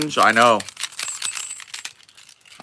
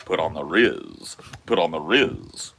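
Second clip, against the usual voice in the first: lower, flat looped phrase; low NPC bot line; 10 semitones lower, 3 dB quieter than usual. Acted. Streamer repeating an NPC catchphrase in a lower voice. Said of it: "bot stream".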